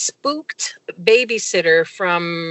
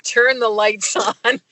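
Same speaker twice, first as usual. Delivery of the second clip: ordinary talk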